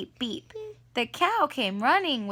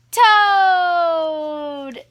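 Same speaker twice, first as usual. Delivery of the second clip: loud, higher, long drawn-out word; grand reveal; 5 semitones higher, 9 dB louder than usual. A narrator's reading. Parent revealing the toad to child, grand drawn-out call. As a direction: announce the hero with a flourish. Big reveal in picture book.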